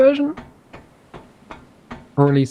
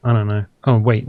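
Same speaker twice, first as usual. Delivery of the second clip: normal talking voice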